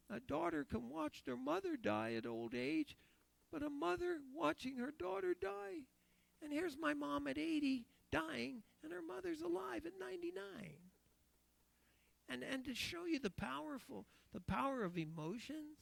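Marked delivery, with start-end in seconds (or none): 0.1-10.9 lightly voiced frail mother, pitched higher; a hint of a dying mother's voice; voicing his mother. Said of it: "She says".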